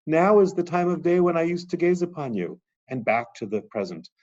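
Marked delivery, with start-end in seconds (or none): 0.1-2.6 high, louder longing; yearning cry. Acted. Teacher voicing the goddess remembering her husband, yearning. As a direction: remember him with aching desire.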